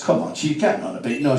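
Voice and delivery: ordinary delivery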